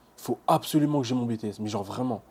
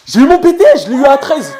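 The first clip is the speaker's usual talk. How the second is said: much higher and louder, thrilled; joyful yelling; 14 semitones higher, 18 dB louder than usual. Real, not acted. Student yells his grade to his mother, joyful shouting. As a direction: yell the good news.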